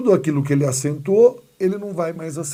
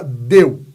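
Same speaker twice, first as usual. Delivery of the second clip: "Deu" with a loud, strong E and weak U; E forte; 3 semitones lower, 9 dB louder than usual. Shown as a demonstration. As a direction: hit the E in "Deu", let the U glide off. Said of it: "O E é forte, o U não". ("Deu" com E forte e U fraco)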